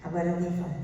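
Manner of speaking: ordinary talk, nothing marked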